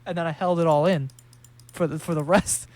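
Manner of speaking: normal talking voice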